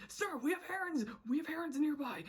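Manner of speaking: high, urgent mock report; mock-panicked soldier warning; alarmed soldier.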